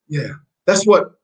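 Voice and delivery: normal talking voice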